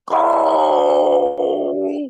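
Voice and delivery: giant's low booming shove of a word; Big Show. Retold or imitated. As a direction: drop way down and boom it out like the giant. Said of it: "And Big Show's like".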